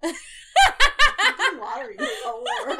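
high, loud, slow laughing burst. Real, not acted. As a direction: blurt it out laughing. Laughing outburst.

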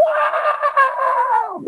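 high shrieking metal scream. Retold or imitated. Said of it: "just somebody screaming".

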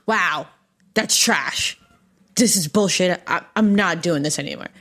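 loud, disgusted self-criticism; frustrated outburst. Retold or imitated. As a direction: snap at your own work. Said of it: "one stroke and you're like".

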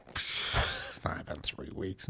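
hushed, slow denial; quiet denial. Retold or imitated. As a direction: mutter it under your breath. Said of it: "I'm like".

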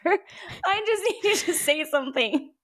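high, laughing through the words. Real, not acted. Podcaster laughing at her own impatience with Merlin. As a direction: giggle the plea out high.

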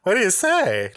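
high, loud smooth-talking pimp; hammed-up cartoon pimp, pitched high. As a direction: sweet-talk her like a cartoon pimp. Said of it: "And he talked like this".

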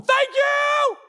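put-on squealed high baby thank-you; a newborn's shrill mock gratitude. Preacher voicing a newborn who is thankful, high squeal. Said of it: "babies don't come out going".

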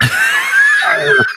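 put-on high drunken yelp; retold drunken yelp. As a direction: squawk like a lost drunk. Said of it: "He's like".

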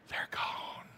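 whispered softly into the silence; hushed whisper. Shown as a demonstration. Preacher whispering to the congregation that the house is quiet. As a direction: breathe it out like a held secret. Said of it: "Shh, listen".

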